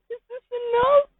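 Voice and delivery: very high, wailing whine of the listener; high crying voice. Acted. The listener confessing to Pimpich in a high crying voice. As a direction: squeak it out like a crybaby.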